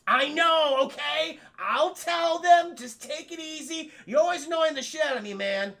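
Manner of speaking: high, loud snapping at an invisible friend; rude, exasperated. Acted. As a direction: drag out the words, irritated and crazed. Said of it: "he gets a little rude".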